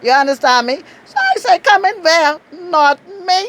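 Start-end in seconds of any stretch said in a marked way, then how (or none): 1.6-3.4 high, firm, a touch louder; mock-indignant not me; sassy refusal. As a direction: wave him off with attitude. Retold refusal to enter.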